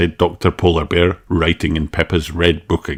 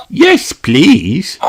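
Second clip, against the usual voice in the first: high, eager cry; eager yes please; 13 semitones higher, 6 dB louder than usual. Acted. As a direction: jump at the offer. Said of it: "cried Peppa".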